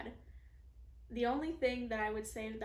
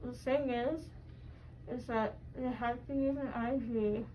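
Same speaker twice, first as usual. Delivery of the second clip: groggy. Real, not acted. Woozy patient.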